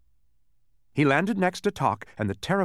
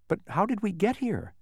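high-pitched puzzled question; 10 semitones higher than usual. A narrator's reading. Milo to Canby about the island, puzzled. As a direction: ask it in mild bewilderment. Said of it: "still a bit puzzled".

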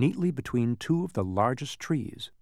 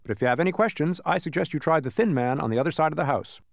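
higher-pitched, helpful character voice; cheerful thin man; 5 semitones higher than usual. Acted. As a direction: suggest it brightly in a raised pitch. Man sends visitors round house.

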